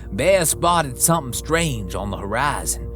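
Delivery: usual speaking voice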